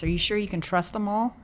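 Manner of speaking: normal talking voice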